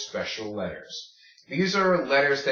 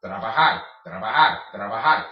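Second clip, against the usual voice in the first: trabajar run together briskly, louder; picking up pace; 5 dB louder, faster than usual. Shown as a demonstration. Teacher speeds up the drill, brisk punched trabajar. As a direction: repeat trabajar quickly as one word. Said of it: "then pick up the pace".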